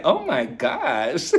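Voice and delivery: drawn-out, giggly disbelief; slow mock gasp of words dissolving into giggles; laughing mock shock. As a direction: stretch it out slowly, scandalized and laughing. Scandalized reaction, breaking into laughter.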